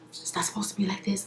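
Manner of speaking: Ray J whispering in disbelief; whispered. Retold or imitated. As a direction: whisper it quickly as he walks off.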